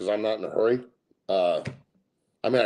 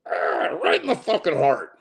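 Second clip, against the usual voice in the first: mock hurt; 10 semitones higher, 3 dB louder than usual. Mock hurt at Arizona joke.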